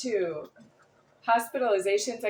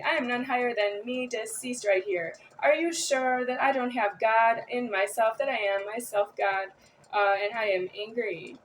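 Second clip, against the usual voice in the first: ordinary talk, nothing marked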